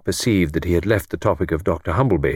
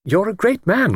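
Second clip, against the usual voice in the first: high, airy mock praise; gently ironic compliment to Gordon; lightly teasing; 13 semitones higher than usual. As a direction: flatter him with a light irony. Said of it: "said lightly".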